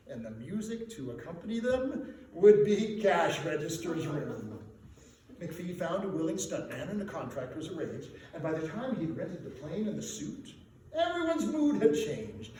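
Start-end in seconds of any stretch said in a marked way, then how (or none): none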